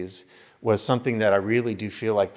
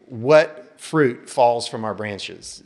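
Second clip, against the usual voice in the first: sermon voice, louder, slowing down at the end; preaching; 2 semitones higher, 6 dB louder than usual. Real, not acted. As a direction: preach it to the congregation, building weight. Pastor preaching opening.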